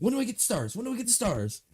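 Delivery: high eager asking; eager whining. Retold or imitated. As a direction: beg for the stars like a kid. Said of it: "Be looking at my watch like".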